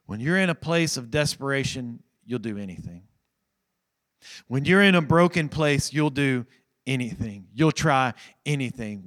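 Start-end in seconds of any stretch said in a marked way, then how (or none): none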